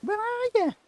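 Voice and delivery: high, far-off mock Scouse echo of the game line; Scouse impression. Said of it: "he's a Scouse at me".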